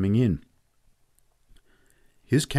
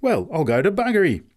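much higher, louder amazed oath; astonished; 9 semitones higher, 6 dB louder than usual. Acted. Factory man astonished at Pat, loud amazed oath. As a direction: swear in disbelief.

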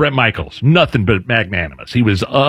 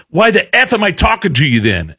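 high, contemptuous mock outburst; lightly voiced angry rocker; mock angry snap; 7 semitones higher than usual. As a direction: scoff and storm off. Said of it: "stormed away".

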